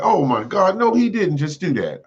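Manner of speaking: high, astonished gasp of disbelief; can't believe he just did that; shocked disbelief. Retold or imitated. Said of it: "I was like".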